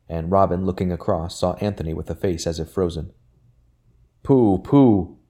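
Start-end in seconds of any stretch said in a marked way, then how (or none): 4.2-5.2 a higher, louder flustered pooh-pooh; uneasy outburst. A narrator's reading. Mister Thomas waving off Anthony's rebuke, uneasy loud bluster. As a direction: brush it off with nervous bluster. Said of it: "burst out Mister Thomas with an uneasy air".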